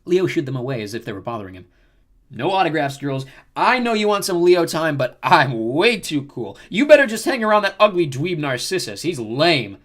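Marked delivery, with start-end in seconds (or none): 2.3-9.8 loud, swaggering, drawn-out brag; cocky showboating. A narrator's reading. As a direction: play too cool for the fans. Leo boasting to the nymphs.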